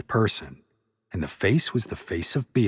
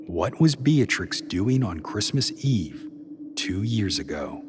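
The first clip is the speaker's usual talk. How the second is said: ordinary delivery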